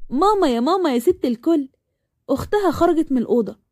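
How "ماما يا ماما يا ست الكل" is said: playful higher-pitched call to mum; lightly hammed-up, lilting affection; joking. A narrator's reading. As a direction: sing out teasingly for your mother. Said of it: "وقالت وهي بتهزر".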